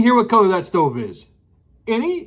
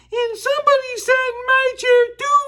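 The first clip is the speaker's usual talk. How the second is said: much higher squeaky little bear voice; squeaky Baby Bear; 13 semitones higher than usual. A narrator's reading. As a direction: squeak it like a tiny bear cub. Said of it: "Baby Bear squeaked".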